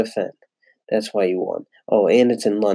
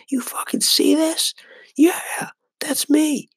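daughter's imagined voice. Pretend. Voicing toddler daughter's proud thoughts.

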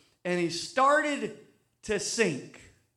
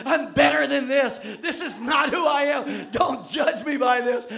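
humiliated protest; 4 semitones higher, 4 dB louder than usual. Retold or imitated. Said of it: "I was so humiliated".

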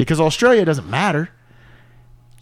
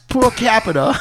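mock Australian; 7 semitones higher, slower than usual. Shared Jeffries impression.

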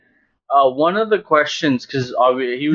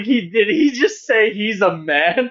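incredulous; 5 semitones higher, slower than usual. Retold or imitated. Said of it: "I was just like".

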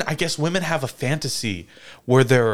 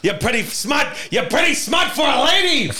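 greaser tough guy; 10 semitones higher than usual. Retold or imitated. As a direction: shout it like a smug chauvinist. Acting a sexist boss.

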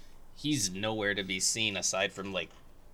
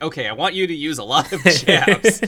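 louder coach voice; 4 semitones higher, 11 dB louder than usual. Retold or imitated. Assistant coach barking instructions from the corner. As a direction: coach calling it out, louder and higher. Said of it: "the assistant coach saying".

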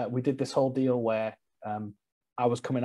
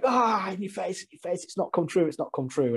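high taunting jeer, fading down; mock jeering fans; mocking crowd; 7 semitones higher than usual. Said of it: "everyone was like".

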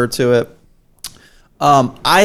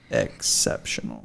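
whispered; 12 dB quieter than usual. Real, not acted. Repeats the word whispered.

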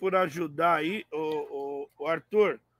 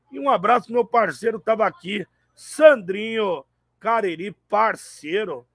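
ordinary talk, nothing marked

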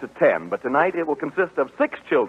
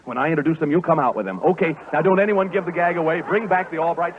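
normal talking voice

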